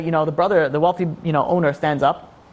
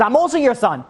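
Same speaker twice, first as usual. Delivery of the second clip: high, indignant retold plea; hurt protest; 7 semitones higher than usual. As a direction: act out an ignored son demanding his place. Acted outburst of ignored son.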